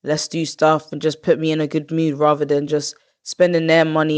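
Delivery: ordinary talk, nothing marked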